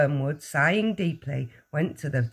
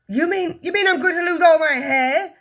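high, loud, appalled yell; stumble, then yell the rising question; 12 semitones higher, 7 dB louder than usual. A narrator's reading. Husband fears losing his hair, yelling. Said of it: "the husband yelled".

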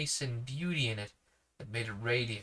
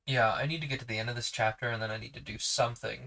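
ordinary delivery

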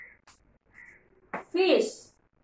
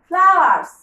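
loud, clear teacher model of flowers; teacher's model word; 6 dB louder than usual. Shown as a demonstration. Teacher showing children the word, loud modelling. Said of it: "এই যে এগুলি কি?".

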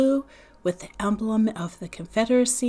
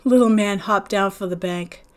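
usual speaking voice

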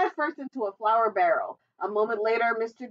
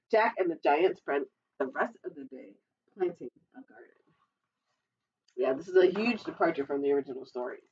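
plain reading voice

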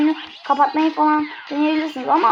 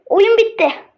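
high loud triumphant shouts; victory cheer; 5 semitones higher, 3 dB louder than usual. Real, not acted. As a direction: celebrate finishing the game. Oyunu bitirince sevinçle bağırıyor. (zafer coşkusu)

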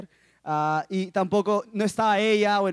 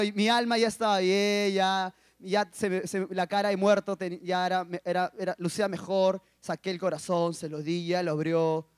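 ordinary talk

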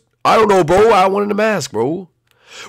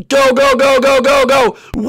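excited cheering; 6 semitones higher, 3 dB louder, slower than usual. Real, not acted. Launching flight, excited.

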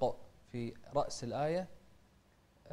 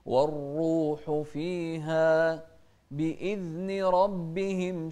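slow, louder chanted recitation; slow tajweed chanting; Quran recitation; 3 semitones higher, 6 dB louder, much slower than usual. Shown as a demonstration. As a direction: draw out each word like tajweed. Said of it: "شوف الكسرة نفس الشيء على الهمزة".

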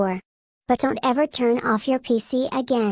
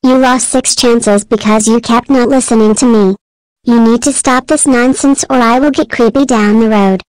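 much louder, furious threat; getting pissed; 12 dB louder than usual. Acted. Miku furiously warns the user who keeps not listening, much louder. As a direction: threaten the user at full volume. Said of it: "I'm getting pissed".

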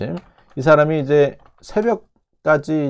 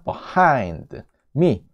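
behind me very slowly, "buh-HIIND me"; weak schwa, then lean long on hind in behind me; stress on HIND; much slower than usual. Shown as a demonstration. Teacher models the phrase after explaining, stress on hind. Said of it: "hind의 강세가 있다 보니까 앞에 있는 거는 죽어버린다".

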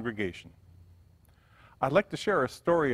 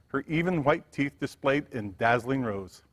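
ordinary delivery

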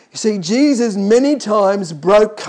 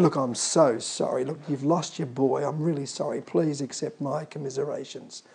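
soft, lower, polite sympathy; polite condolence; 5 semitones lower, 8 dB quieter than usual. Retold or imitated. Preacher voicing a man offering polite sympathy, softly.

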